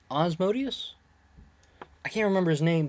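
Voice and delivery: usual speaking voice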